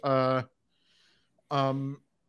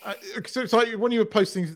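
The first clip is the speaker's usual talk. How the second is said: ordinary talk, nothing marked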